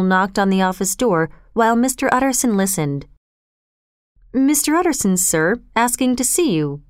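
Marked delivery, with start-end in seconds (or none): none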